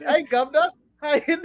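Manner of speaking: hammed-up British accent, half laughing; mock British accent. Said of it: "when you try and impersonate me".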